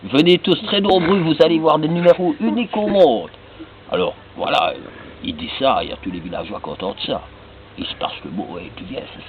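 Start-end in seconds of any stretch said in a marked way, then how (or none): 0.0-3.3 high, loud fairground announcement; showman announcer. Acted. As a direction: sell the show to the crowd. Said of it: "il monte sur son estrade".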